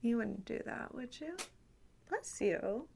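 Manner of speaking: ordinary talk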